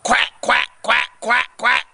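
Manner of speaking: imitated duck quacks; five brisk pretend quacks; duck quacking. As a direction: quack like a duck in short bursts. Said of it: "And Bark goes".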